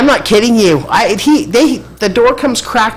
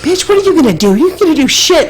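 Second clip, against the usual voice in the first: mock threat; 10 semitones higher than usual. Singer threatening her.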